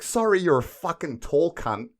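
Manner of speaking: mock flight attendant. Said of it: "She, like".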